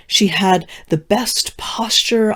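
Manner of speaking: whisper. Acted. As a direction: breathe it like a secret. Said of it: "unvoiced whisper".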